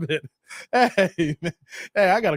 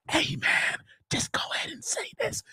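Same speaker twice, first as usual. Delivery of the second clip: mock whisper; 13 semitones higher, 9 dB quieter than usual. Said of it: "God is saying".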